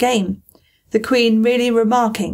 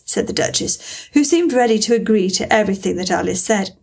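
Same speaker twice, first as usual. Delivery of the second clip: ordinary delivery, nothing marked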